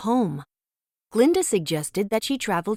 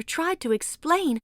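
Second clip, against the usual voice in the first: ordinary delivery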